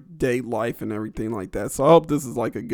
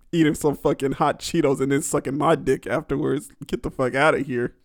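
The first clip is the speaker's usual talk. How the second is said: ordinary talk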